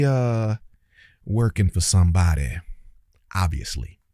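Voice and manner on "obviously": ordinary delivery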